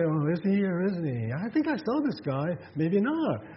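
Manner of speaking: acted doubtful neighbors, pitch lifted; puzzled onlookers. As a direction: wonder aloud as an unsure bystander. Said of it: "they're trying to say".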